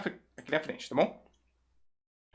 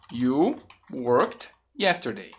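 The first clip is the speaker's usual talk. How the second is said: "You... worked... yesterday", spaced word by word; slow careful model; much slower than usual. Shown as a demonstration. Teacher gives learners the affirmative, slow and deliberate.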